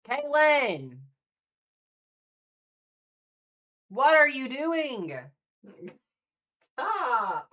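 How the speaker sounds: loud high shouting of "Caitlin!", "Stop!"; mock outrage shout. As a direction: yell at her in playful disbelief. Donor sends more gifts.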